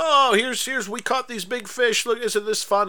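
high-pitched, put-on delight; mock excitement. Podcaster voicing the men posing with their fish, mock excited. Voicing fake fishermen showing off catch.

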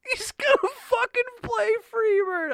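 very high, loud excitement; re-enacted giddy excitement; thrilled squeal. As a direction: squeal it, imitating a stoked fan. Retelling thrilled reaction, stoked.

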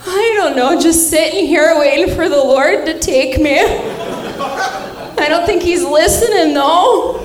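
lightly voiced plaintive old grandmother; weary grandma. Grandma on the phone to granddaughter, mildly plaintive. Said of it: "She's like".

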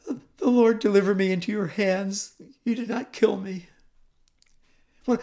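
high, stammering, quavering plea; pathetic quavering voice. Acted. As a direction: falter pitifully, stumbling on the first words. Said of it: "with a quavering voice".

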